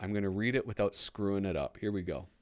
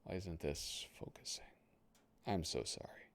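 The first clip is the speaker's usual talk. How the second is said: whisper; 4 semitones lower, 13 dB quieter than usual. Real, not acted. Frustrated with camera focus.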